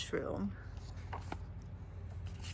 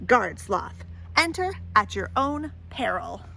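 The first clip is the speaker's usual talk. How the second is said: storybook read-aloud voice; lively picture-book reading; story narration; 4 dB louder than usual. A narrator's reading. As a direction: tell it to a child at bedtime.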